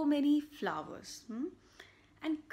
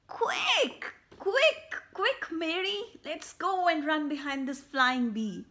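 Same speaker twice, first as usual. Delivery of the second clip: much higher, louder, quick cries calming by the end; urgent shout; 6 semitones higher, 7 dB louder, slower than usual. Acted. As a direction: call her to hurry after the bee. Grandpa urging chase after bee.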